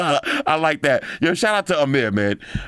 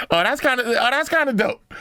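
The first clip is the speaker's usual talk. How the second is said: much higher, delighted oh; excited; 12 semitones higher than usual. Real, not acted. Watching a cool TikTok, delighted high excitement. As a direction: pitch it way up in sudden delight.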